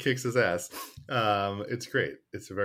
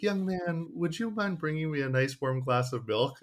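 put-on lightly higher sweet old grandma; old lady voice; 7 semitones higher than usual. Grandma asks the nurse for milk, sweet and higher. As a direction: ask sweetly like a frail grandmother.

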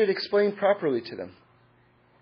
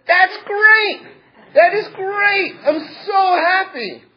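much higher, louder, slow beaming exclaim; overjoyed rabbi; 12 semitones higher, 6 dB louder, slower than usual. Acted. Rabbi hears the student is agnostic, overjoyed and loud. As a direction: burst with joy, draw it out.

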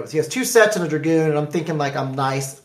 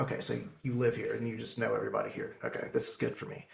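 hushed, quick sarcastic mutter; sarcastic aside; 7 semitones lower, 17 dB quieter, faster than usual. Retold or imitated. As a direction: say it under your breath, dripping irony. Sarcastic aside about local judges.

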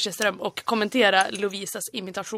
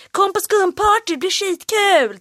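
loud, high hype pitch, drawn-out end; pushy club promoter; 9 semitones higher, 5 dB louder, slower than usual. Retold or imitated. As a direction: sell the foam party to passing tourists. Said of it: "och typ". (påträngande klubbutropare)

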